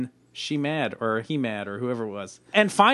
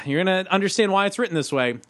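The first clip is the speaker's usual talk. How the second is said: ordinary talk, nothing marked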